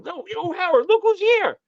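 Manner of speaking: very high-pitched call-out; retold excited hailing. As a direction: imitate calling across to a friend. Don hails Howard, acted out.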